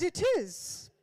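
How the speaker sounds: high-pitched "It is" in borrowed posh English; mock-posh reply. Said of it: "I said".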